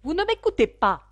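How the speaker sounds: high, loud narrated scolding; stern. A narrator's reading. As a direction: rebuke an inattentive listener sharply. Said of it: "d'un air sévère". (ton sévère de réprimande)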